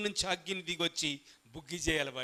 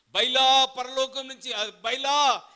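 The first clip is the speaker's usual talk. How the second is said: slow, loud, higher mock pleading; mock prayer to Baal; 5 semitones higher, 7 dB louder, slower than usual. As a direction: ham up the prophets begging Baal. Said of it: "ప్రార్థన చేస్తున్నారండి". (బయలు ప్రవక్తల ఎగతాళి ప్రార్థన)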